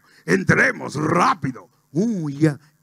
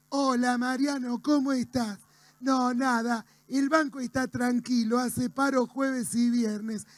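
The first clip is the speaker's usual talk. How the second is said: softer, higher monotone reporter voice; Titi Fernández; 4 semitones higher, 4 dB quieter than usual. Acted. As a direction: report back calmly, on one soft high note. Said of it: "Titi Fernández, contame cómo está". (cronista de campo, suave, agudo y monótono)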